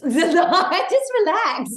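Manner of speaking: laughing it out, slow and loud, high; drawn-out laughing relax. Real, not acted. Telling herself to relax, laughing. Laughing at her own self-talk.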